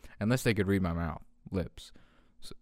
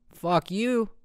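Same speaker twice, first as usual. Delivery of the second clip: much higher, rising second jab; mock retort; 9 semitones higher than usual. Host answering imagined critics, high mock retort. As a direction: throw it back in a high, teasing pitch.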